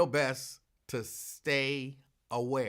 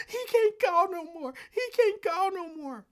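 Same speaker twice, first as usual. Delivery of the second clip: high, loud wailing sob; high, loud, tearful wail of grief; imitating crying coworker; 7 dB louder than usual. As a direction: wail it out like she's hysterical in tears. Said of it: "she is crying".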